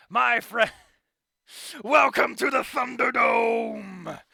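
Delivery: loud, high, slow hype shout; showman announcer. Acted. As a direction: bellow it like a ringmaster. Announces the show to crowd.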